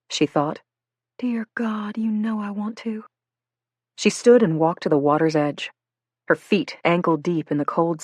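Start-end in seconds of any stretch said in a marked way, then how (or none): 1.2-3.0 breathless whisper of a secret prayer. Acted. Girl silently praying to God while crying, whispered. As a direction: pray it under your breath, voice gone.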